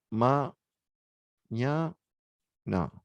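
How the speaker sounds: Ma, ña, na split into separate syllables with pauses. Shown as a demonstration. Teacher checks the recording, saying it syllable by syllable. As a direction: break mañana into its syllables, one at a time. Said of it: "No me escucho bien".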